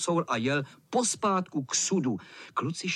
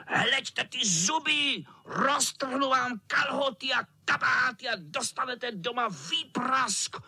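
high, menacing cat voice; hammed-up wicked cat; threatening tomcat; 8 semitones higher than usual. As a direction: draw out the threat in a squeal.

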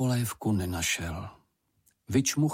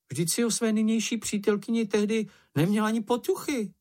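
high-pitched small creature's protest; crying out; 12 semitones higher than usual. A narrator's reading. Čenich contradicts Mumínek about his girlfriend, crying out. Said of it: "vykřikl Čenich".

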